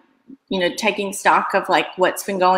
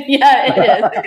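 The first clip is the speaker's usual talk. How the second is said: laughing; 5 semitones higher, 6 dB louder, slower than usual. Real, not acted. Laughing at the Muppet voice remark.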